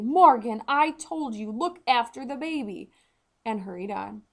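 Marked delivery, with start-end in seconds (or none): 0.0-2.9 sharp, loud scolding call over the shoulder; angry call back. A narrator's reading. Mother hurrying to the king scolds daughter, loud and angry. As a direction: snap back at the child while hurrying off. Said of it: "she called back angrily".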